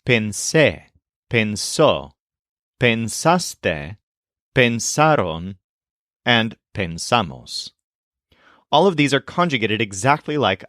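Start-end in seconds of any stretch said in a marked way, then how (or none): 0.0-5.6 pensé, pensó, pensaste, pensaron stressed just after pens; measured teacher's list, pensé and pensó stressed at the end. Shown as a demonstration. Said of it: "It's also true of all the preterite forms". 6.7-7.7 pensamos stressed on the middle syllable; stress after pens. Shown as a demonstration. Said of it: "It's also true of all the preterite forms".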